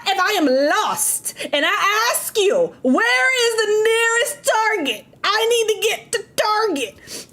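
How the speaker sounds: hammed-up lost shopper; mock lost asker. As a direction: play the lost, helpless asker. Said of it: "acting a lost person asking".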